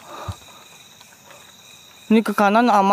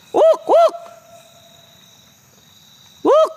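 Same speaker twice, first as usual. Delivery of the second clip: very high imitated monkey whoop; monkey call; much slower than usual. Mimicking the monkey heard in the dark, very high. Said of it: "suara monyet".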